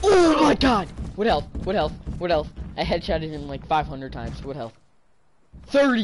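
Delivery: furious screaming at the game; raging gamer. Real, not acted. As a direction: yell in disbelief, loud and high. Teammate furious after headshots.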